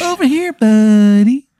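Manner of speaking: very high, drawn-out falsetto hail; calling over. Acted. Man at Applebee's beckons his buddy, in high falsetto. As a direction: call a pal over in a squeaky put-on voice.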